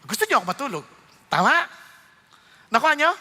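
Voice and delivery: ordinary talk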